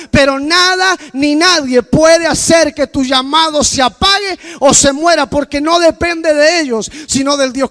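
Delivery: loud, slow preaching cry; preaching at full force. Acted. Preacher shouting to his congregation, loud and slow. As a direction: proclaim it to the whole church.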